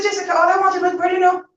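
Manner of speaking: exasperated protest. Retold or imitated. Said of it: "I said".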